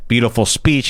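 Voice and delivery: ordinary talk, nothing marked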